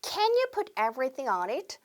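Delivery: very slow rising question, stress on you; slow model question. Shown as a demonstration. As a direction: model the request slowly for repeating. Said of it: "첫 번째". (천천히 끝을 올리는 시범 질문)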